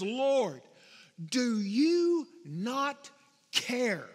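pleading, drawn out and slowed on not; exasperated plea stretched slow; frustrated. Acted. Martha complaining to Jesus, frustrated and pleading. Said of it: "She is frustrated".

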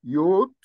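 يوت said clearly as yot; pronounced yot. Shown as a demonstration. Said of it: "وده النطق".

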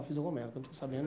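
quiet anxious inner voice; soft nervous self-talk; worried murmur. Retold or imitated. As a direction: mutter it to yourself, worried. Worried inner thought, much softer.

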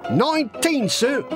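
high, keen, fibbing lad; eager boy recruit. Acted. Boy lying about his age to the sergeant, high. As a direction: answer smartly in a high young voice.

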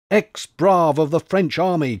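giddy shout, voice lifted; mad exhilaration. Acted. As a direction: call out to him half drunk and elated. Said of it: "in a mad state of exhilaration".

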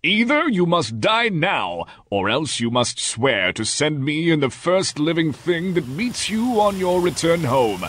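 high, drawn-out beast's ultimatum; awful beast. A narrator's reading. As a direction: pitch it up and deliver the threat as the Beast. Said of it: "exclaimed in an awful tone".

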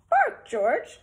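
high, loud scolding mum; loud mother command. Acted. Mother tells her puppy, loud and high. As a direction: call it out like a firm mum. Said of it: "George's mother said".

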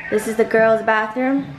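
normal talking voice

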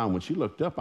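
ordinary talk, nothing marked

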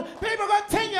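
excited announcement. Retold or imitated. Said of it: "she came over".

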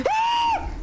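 high startled scream; scared scream. Real, not acted. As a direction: shriek of fright.

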